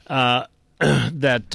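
ordinary delivery

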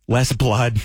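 ordinary delivery

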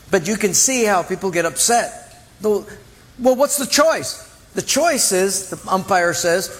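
normal talking voice

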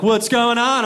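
high, loud squawked question; loud comic eagle voice; heroic bald eagle. Acted. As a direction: swoop in shouting like an eagle hero. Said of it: "In comes a bald eagle".

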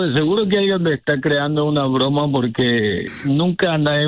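ordinary delivery

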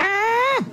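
very high, drawn-out "eh"; stretched comic shrug sound; Italian shrug noise. Shown as a demonstration. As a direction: squeal a long careless "eh". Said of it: "This is a gesture in Italy that means".

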